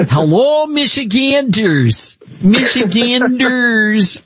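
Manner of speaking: high, slow, drawn-out Michigan accent, mock-sweet; Haley Stevens's Michigan accent. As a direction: greet the crowd in a stretched-out, high Michigan accent. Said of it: "I love her accent".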